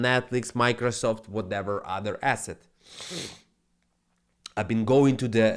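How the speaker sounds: normal talking voice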